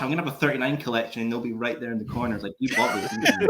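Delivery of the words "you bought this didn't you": high squeaky mocking voice of the belts; taunting belts. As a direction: tease him like a toy that knows he caved. Belts in the corner taunting him.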